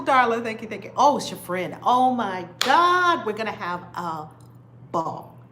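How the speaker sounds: ordinary delivery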